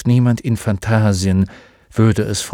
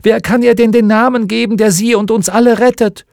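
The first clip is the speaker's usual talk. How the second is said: high, loud frantic cry; beside himself shouting; 10 semitones higher, 6 dB louder than usual. A narrator's reading. Atreo screaming at the old one, frantic high shout. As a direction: scream it out in desperation. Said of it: "schrie Atreo außer sich".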